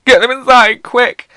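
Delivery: high, loud shrill-free yell of a fussing teacher; panicky teacher. Retold or imitated. Playground teachers herding children in from rain, high and loud. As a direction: shout the children in like a panicked referee. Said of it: "they go".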